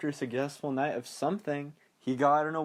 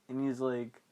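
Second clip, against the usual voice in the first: ordinary talk, nothing marked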